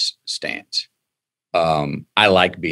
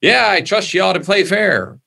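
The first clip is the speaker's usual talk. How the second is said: mock naive; 11 semitones higher than usual. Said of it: "come on and go".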